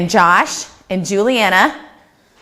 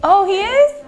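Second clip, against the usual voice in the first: high surprised question; 10 semitones higher than usual. Real, not acted. Teacher surprised to hear a boy is older, asking high. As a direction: sound caught off guard.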